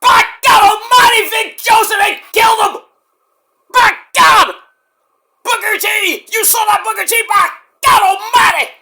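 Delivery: high, loud, frantic play-by-play shouts; hammed-up announcer. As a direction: go full legendary commentator losing it. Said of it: "I'm like".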